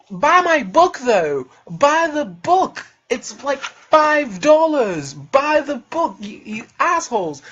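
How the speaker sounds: loud, drawn-out exasperated demand; exasperated plea. Real, not acted. Podcaster nagging his audience to buy his book, loud and exasperated. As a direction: plead loudly, stretching the key words.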